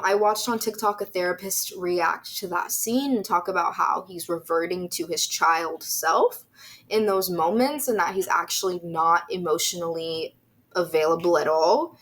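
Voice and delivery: normal talking voice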